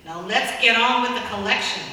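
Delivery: impatient, demanding the sale move on; shout. Acted. Man in the second row shouts at the auctioneer, impatient. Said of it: "A man sitting in the second row shouted".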